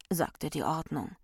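ordinary delivery, nothing marked

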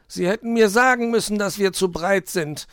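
high, loud sulky complaint; grumbling fox. A narrator's reading. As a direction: grouse at your partner as the fox. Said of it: "maulte der Fuchs". (maulender Fuchs, hoch und laut)

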